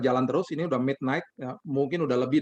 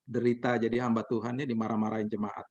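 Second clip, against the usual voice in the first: ordinary delivery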